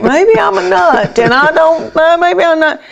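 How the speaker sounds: high, searching inner doubt; self-doubting inner voice. Retold or imitated. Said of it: "you know, I'd think".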